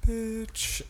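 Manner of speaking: mimicked bitch intonation. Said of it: "that intonation".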